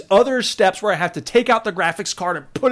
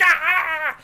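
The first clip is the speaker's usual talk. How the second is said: frustrated yelp; 11 semitones higher than usual. Retold or imitated. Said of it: "I was like".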